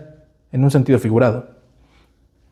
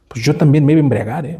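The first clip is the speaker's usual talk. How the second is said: ordinary delivery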